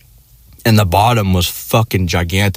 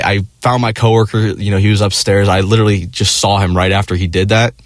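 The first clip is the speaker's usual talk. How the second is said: ordinary delivery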